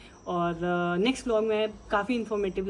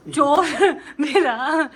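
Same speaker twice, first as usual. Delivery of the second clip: laughing; 7 semitones higher, 9 dB louder, much slower than usual. Real, not acted. Laughs at husband taking jug.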